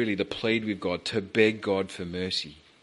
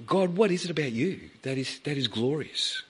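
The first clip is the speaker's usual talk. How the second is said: high, wondering plea; 5 semitones higher than usual. Retold or imitated. Moses asking God, high.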